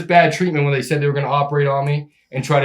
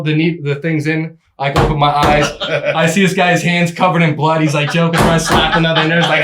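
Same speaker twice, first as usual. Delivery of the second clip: normal talking voice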